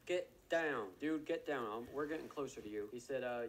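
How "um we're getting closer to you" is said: usual speaking voice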